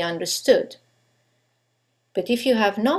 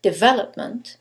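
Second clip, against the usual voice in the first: "development" with VEL punched and the other syllables light; deVELopment, asked with a rising tune; stress on second syllable. Shown as a demonstration. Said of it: "Is it the second one".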